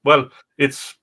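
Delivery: ordinary delivery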